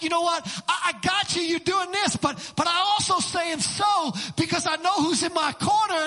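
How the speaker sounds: loud, high, fired-up retort; confident defiance. Acted. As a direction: stand up to the attack, shouting. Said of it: "it's a so of, like".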